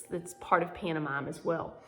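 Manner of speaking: ordinary delivery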